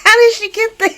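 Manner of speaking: high, loud, incredulous cry; stunned disbelief. Real, not acted. Fan reacting to a soap plot twist, stunned and loud. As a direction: shriek it in total disbelief.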